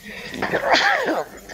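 loud allergy sneeze; a real sudden sneeze; sneezing. Real, not acted. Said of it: "Boy, excuse me".

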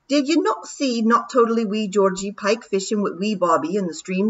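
proud wee pixie bragging in broad Scots; broad scottish accent. Acted. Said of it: "said Rob".

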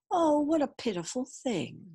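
lightly voiced sympathetic mother; a touch of motherly pity. A narrator's reading. Mother pities the hurt dragon.

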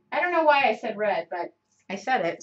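usual speaking voice

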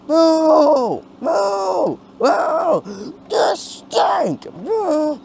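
high, loud mooing jeers; jeering crowd. Acted. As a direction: boo them off like a rowdy crowd.

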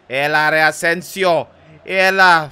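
loud shouted encouragement; big, pushing cheer; urging on. Real, not acted. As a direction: call out forcefully to the player. Urging player on.

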